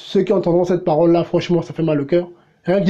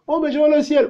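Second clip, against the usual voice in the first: smug believer; 7 semitones higher, faster than usual. Retold or imitated. Mocking a complacent believer.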